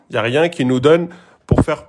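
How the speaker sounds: ordinary delivery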